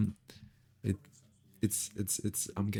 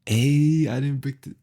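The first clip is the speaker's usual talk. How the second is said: shouting; 7 semitones higher, 13 dB louder than usual. Real, not acted. Mic check shouting at stream.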